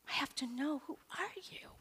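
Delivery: hushed, breathy whisper; whispered, eager question. Retold or imitated. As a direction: whisper it like a secret plea. Seeker asking quietly.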